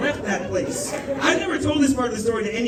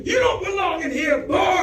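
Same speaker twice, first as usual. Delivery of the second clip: raised, hostile bark at a stranger; 8 semitones higher, 3 dB louder than usual. Acted. Comic imagines casting the man, hostile yell. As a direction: sneer it like an old bigot in a booth. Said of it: "get up out of a booth and say".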